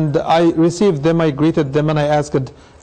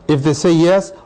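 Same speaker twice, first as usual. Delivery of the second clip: normal talking voice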